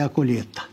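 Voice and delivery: "colheita" said as "colheta"; Lula's pronunciation. Retold or imitated. Commentator mocking the president's promise, "colheita" as "colheta". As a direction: drop the i from "colheita": co-LHE-ta. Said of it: "Pronúncia do nosso presidente analfabeto".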